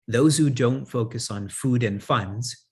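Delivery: normal talking voice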